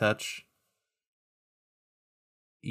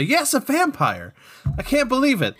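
higher own voice; 10 semitones higher than usual. Pretend. Answers as Bradley, higher.

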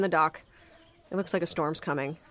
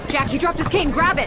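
shouting; 9 semitones higher, 5 dB louder than usual. Acted. Rescue shouts on stormy dock.

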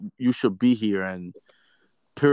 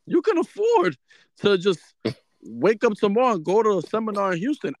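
exasperated retort; 9 semitones higher than usual. Retold or imitated. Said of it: "I was like".

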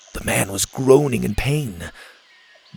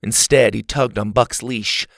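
ordinary delivery

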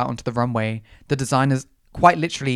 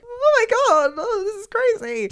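very high, put-on fangirl squeal; starstruck squeal. Reacting to being recognised by a fan, mock-thrilled squeal.

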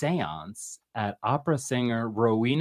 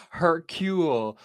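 French accent; 6 semitones higher, slower than usual. Shown as a demonstration. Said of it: "Okay, I'm gonna try".

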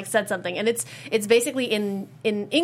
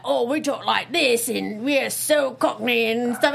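hammy fake Cockney, slow; mock Cockney accent; slower than usual. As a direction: put on a bad Cockney accent. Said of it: "have the same Cockney accent".

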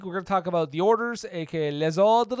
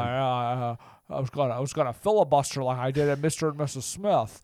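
put-on halting old movie star, lower; Jimmy Stewart; 4 semitones lower than usual. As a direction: stammer evasively as an old Hollywood actor.

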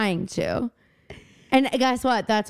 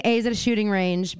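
ordinary talk, nothing marked

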